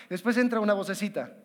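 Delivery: ordinary delivery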